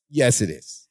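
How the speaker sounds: deadpan. Retold or imitated. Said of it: "Larry just looks at him and goes".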